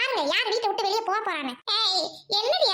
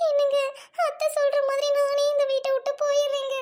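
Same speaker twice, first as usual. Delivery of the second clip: high, tearful offer to leave; 6 semitones higher than usual. Acted. Wife tearfully telling husband she will leave the house. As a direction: cry as you give in.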